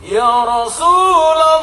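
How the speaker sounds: long, loud, high drawn-out call. Retold or imitated. Husband hailing his wife from the doorway, drawn-out shout. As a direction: holler it slowly from the bedroom door. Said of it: "langsung teriak dari depan".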